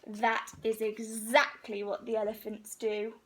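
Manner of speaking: usual speaking voice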